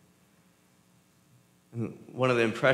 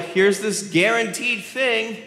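game show pitch; 9 semitones higher, 5 dB louder, slower than usual. Retold or imitated.

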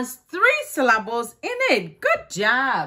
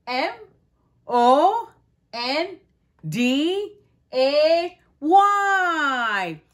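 slow spelling; 4 semitones higher, much slower than usual. Shown as a demonstration. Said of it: "will you help me do that".

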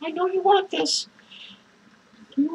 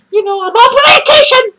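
manic shout; 5 semitones higher, 11 dB louder, faster than usual. Acted. Manic clown bragging.

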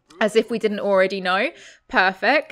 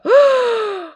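gasp; 12 semitones higher, 6 dB louder than usual. Real, not acted. Delighted gasp at the money.

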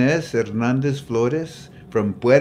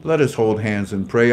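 ordinary talk

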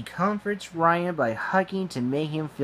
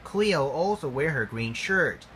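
ordinary delivery

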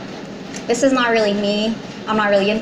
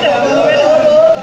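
put-on Indian accent; 5 dB louder than usual. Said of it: "Indian accent".

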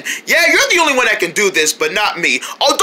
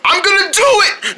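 excited defiance; 8 semitones higher than usual. Acted. Defiant puffin in argument.

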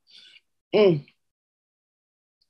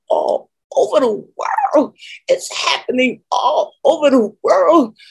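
overcome, voice lifting into a real cry; tearful preaching; 8 semitones higher than usual. Real, not acted. Preacher telling the beloved they are being gathered, tearfully exultant.

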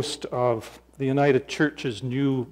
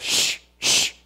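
long unvoiced shush. People silencing old blind Bartimaeus, shushing. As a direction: hush him so others can hear. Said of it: "they're saying to Bartimaeus".

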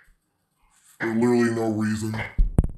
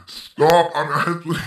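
ordinary delivery

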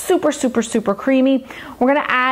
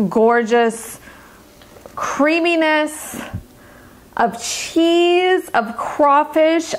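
slow, drawn-out rapture, pitched up; savoring delight; 5 semitones higher, much slower than usual. Real, not acted. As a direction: linger on each word, lovingly. Raving about the dish.

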